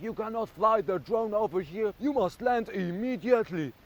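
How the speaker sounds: high-pitched imitation of French-accented orders; mock French accent. Retelling police ordering the drone down, mock French accent. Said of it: "In their really funny French accent".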